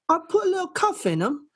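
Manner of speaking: put-on high country girl twang; country accent. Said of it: "So you get the accent".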